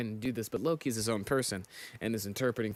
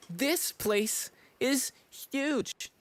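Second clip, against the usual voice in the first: high, very slow awestruck voice; awed; 14 semitones higher, much slower than usual. Acted. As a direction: gasp at how huge it is. Character awed by huge castle.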